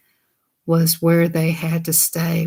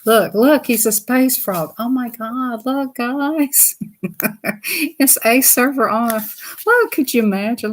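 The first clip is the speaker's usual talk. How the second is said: thrilled, pitched-up chatter; excited; 5 semitones higher than usual. Real, not acted. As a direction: gush over the pictures. Excited over book pictures.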